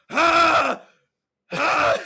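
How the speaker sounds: loud, high strangled gasp; winded gasping. Acted. Tackled kid on the ground, loud winded gasping. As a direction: gasp for air with the wind knocked out.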